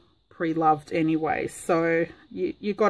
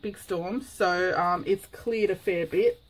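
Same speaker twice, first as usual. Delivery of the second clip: ordinary delivery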